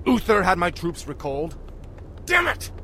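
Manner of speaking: furious disbelief; angry outburst. Acted. Arthas furious at news.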